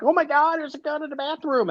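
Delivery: mock panicked high squeal; freaked-out passenger. Mocking a panicked passenger who finds a gun, high squeal. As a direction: ham up a passenger losing it over a gun.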